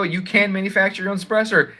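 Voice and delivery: ordinary talk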